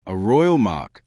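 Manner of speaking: plain reading voice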